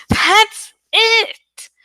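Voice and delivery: high, loud sudden cry of discovery; excited yell. A narrator's reading. Cassie suddenly cracks the puzzle, high excited yell. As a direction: burst out with the realization. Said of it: "Cassie yelled".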